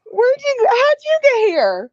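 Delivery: high, loud squealed question; playful high squeal. Pretend. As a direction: squeal it in mock surprise.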